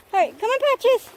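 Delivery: calling the dog on, pitched high. Real, not acted. Owner calling Patches to follow, high and bright. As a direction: sing out his name to bring him along.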